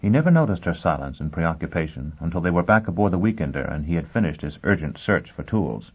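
ordinary delivery, nothing marked

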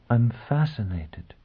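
slow, soft, drawn-out woman's reply; slow answer. A narrator's reading. Woman answering the man she stares at, slowly. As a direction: stretch it out, softly and deliberately. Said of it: "Gisbella answered slowly".

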